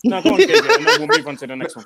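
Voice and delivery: high laughing voice. Real, not acted. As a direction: laugh while handing over. Host laughing.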